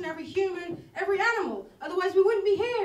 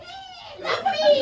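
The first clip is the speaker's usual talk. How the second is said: vain woman; 12 semitones higher, 6 dB louder, much slower than usual. Retold or imitated. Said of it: "They'd be like".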